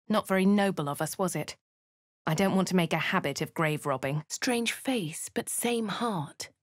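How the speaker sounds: ordinary talk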